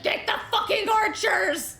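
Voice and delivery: high, loud mock-furious shout through laughter; laughing mock rage at the archers; enraged laughing yell. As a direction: bellow the order like a raging brute, half laughing. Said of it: "he's, like, enraged".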